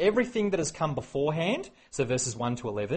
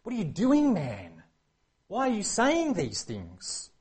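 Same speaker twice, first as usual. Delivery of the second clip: exasperated plea; 7 semitones higher, slower than usual. Retold or imitated. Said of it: "just want to shake him".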